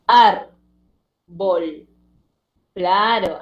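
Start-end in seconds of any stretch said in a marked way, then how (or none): 0.1-1.9 "ar" and "bol" as separate units with a pause between; isolated syllables. Shown as a demonstration. Teacher showing syllables with coda, "ar" and "bol" split apart. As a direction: punch "ar", pause, then drop onto "bol". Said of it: "es decir, presenta coda".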